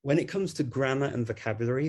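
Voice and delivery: usual speaking voice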